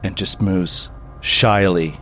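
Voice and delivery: low, soft, slow narration; creepy storyteller, quiet and unhurried; game master's scene setting. Acted. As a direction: draw out the eerie reveal in a hush.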